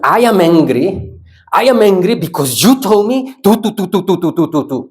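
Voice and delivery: high-pitched acted tirade into rattled syllables; mock anger. Shown as a demonstration. Teacher telling a hated person off, mock angry. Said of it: "we will tell that person".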